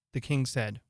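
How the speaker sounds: ordinary delivery, nothing marked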